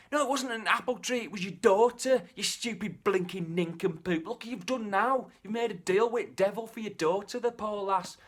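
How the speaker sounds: high, loud furious telling-off; a wife scolding her husband over their daughter, high and angry. Acted. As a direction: berate him as the outraged wife.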